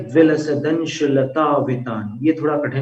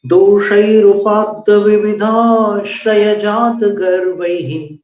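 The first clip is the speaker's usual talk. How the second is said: verse recitation; 5 semitones higher, slower than usual. Shown as a demonstration. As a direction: recite each line with care.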